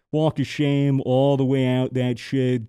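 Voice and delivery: ordinary talk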